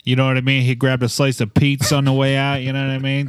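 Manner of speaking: hammed-up Brooklyn wise guy; Brooklyn accent. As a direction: play a New York pizza guy. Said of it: "Your Brooklyn accent".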